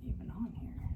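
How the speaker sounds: muttered whisper; whispering to self. Real, not acted. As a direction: mutter quietly to yourself. Muttering while fiddling with figure.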